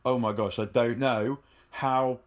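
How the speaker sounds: normal talking voice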